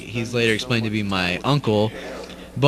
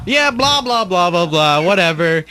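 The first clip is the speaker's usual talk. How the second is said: dismissive; 6 semitones higher, 3 dB louder than usual. Retold or imitated. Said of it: "everybody's like".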